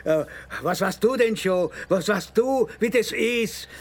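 a father yelling about his dead son at the man trying to calm him; furious shout. Acted. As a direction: scream the loss at him, building to a peak. Grieving father's furious outburst.

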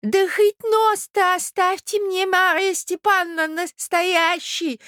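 very high, drawn-out plea; stunned old prince crying out. A narrator's reading. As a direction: wail in protest as a doddering prince. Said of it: "вскричал князь, ошеломлённый". (ошеломлённый старый князь вскрикивает)

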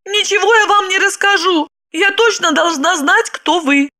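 high-pitched obstinate refusal; stubborn. A narrator's reading. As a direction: dig your heels in, high woman's voice. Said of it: "Она заупрямилась". (упрямо, высоким голосом)

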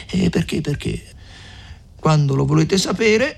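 lightly voiced older woman pressing her plan; a hint of a shrewd mother urging them on; Agnese, eager. A narrator's reading. Mother urging the young couple, lightly voiced.

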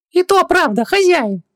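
very high squeaky djinn; tiny, high sidekick voice; Хубаксис, agreeing. Acted. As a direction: chime in eagerly to the master. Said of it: "поддакнул Хубаксис".

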